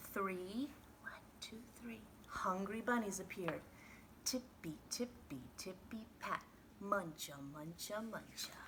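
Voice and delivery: soft, whispered bunny steps; sneaky hush. A narrator's reading. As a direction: tiptoe the words in. Bunnies sneak into the garden.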